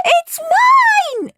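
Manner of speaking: put-on very high, loud child's shriek. A narrator's reading. Peter fighting Henry for the box, shrieking. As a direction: squeal it at full pitch. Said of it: "shrieked Peter".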